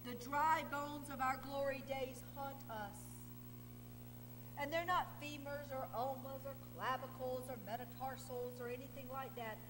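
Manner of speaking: normal talking voice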